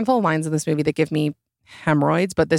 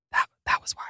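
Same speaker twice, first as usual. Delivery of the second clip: breathy whisper, no voice; whispered aside; 6 dB quieter, faster than usual. Real, not acted. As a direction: drop to a conspiratorial whisper. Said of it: "whispered aside".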